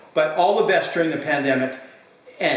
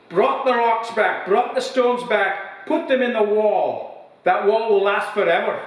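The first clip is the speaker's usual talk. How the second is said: put-on proud old man; 8 semitones higher than usual. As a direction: brag like a weathered old villager. Old man in pub joke.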